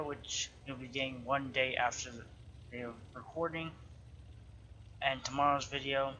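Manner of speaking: ordinary talk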